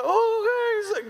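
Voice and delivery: high, drawn-out mock whine; whiny falsetto surrender; reluctant son. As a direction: give in like a sulky kid.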